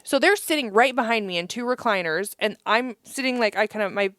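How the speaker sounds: ordinary delivery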